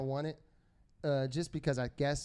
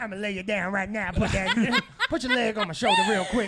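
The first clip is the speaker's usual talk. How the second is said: hammed-up seductive New York voice, higher and louder through laughter; mock sexy talk; 4 semitones higher, 6 dB louder than usual. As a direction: play the smooth seducer as a joke. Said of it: "How does he speak sexy?".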